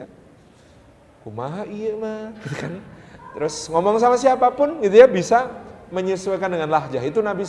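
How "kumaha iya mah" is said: soft Sundanese lilt, much quieter; Sundanese accent. Shown as a demonstration. Preacher shows how to talk with Sundanese people, soft Sundanese lilt. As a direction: let "Kumaha iya mah" wind softly, Sundanese style. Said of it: "harus diliukkan bahasanya".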